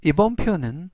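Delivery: normal talking voice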